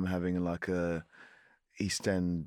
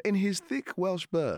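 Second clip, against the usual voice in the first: slow, drawn-out quoted line, pitched higher; slow, pointed reading; 10 semitones higher, slower than usual. Retold or imitated. Narrator mock-reading a late accent note from the book, slow and pointed. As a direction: read it out slowly as a punchline. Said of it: "it'll say".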